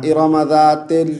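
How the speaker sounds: ज़ातिल spoken ending in "tel"; wrong te vowel. Shown as a demonstration. Teacher shows the mistake, ज़ातिल ending "tel". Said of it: "तिल नहीं पढ़ना".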